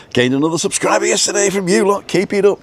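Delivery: quick, very high mock Welsh accent; put-on Welsh accent. Cheering on a viewer's new subscriber, put-on Welsh accent. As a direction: rattle it off in a high Welsh lilt. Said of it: "speak like that".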